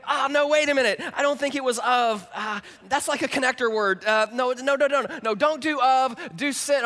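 high, loud flustered self-correction; mock confused sharer. Pastor acting out a flustered friend, loud and comic. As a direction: play the fumbler.

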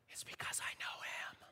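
breathy hushed whisper. Shown as a demonstration. Preacher demoing a spooky whispered answer to coworkers. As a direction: whisper it like a secret. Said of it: "you don't have to whisper it".

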